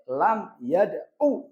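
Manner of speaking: slow Lam yada'u, last u kept brief; yada'u without a long uu at the end; short final vowel. Shown as a demonstration. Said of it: "Karena wawunya dibuang".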